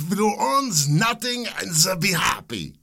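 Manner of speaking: high, hammed-up foreign accent; Klaus Schwab. Host playing Schwab for laughs, mock foreign accent. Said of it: "I don't know what kind of accent that is".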